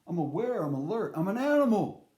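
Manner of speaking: building to a loud, high animal cry; mock intense. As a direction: hype yourself up like a wild animal.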